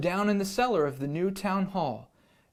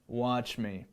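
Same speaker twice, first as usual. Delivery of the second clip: lion teacher voice; 5 semitones lower, 6 dB quieter than usual. Acted. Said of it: "said the lion".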